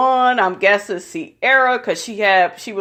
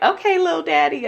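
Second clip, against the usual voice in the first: higher, quick, teasing little voice; mock flirty reply; 8 semitones higher, faster than usual. Woman answering her ex's nickname, higher and teasing. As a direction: toss it back sweetly, a touch mocking. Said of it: "And she was like".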